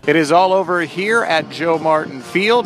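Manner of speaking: upbeat post-game host voice; bright on-field announcer delivery; sports broadcaster. Acted. Post-game play-by-play host wrap.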